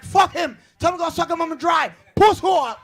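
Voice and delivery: high, loud mock heckle; crowd heckling. Crowd heckling the comedian, loud and high. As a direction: jeer like a rowdy crowd. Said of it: "They was like".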